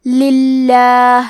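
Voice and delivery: للہ recited very slowly with a thin, light لام; thin lam. Shown as a demonstration. Tajweed teacher showing thin lam, slow recitation. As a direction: chant للہ drawn out, keeping the لام thin. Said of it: "حرف اللام کو پتلی آواز میں پڑھیں گے".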